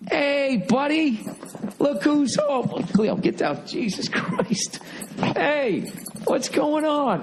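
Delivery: high doggy talk to a pet. Real, not acted. Greeting his dog coming home.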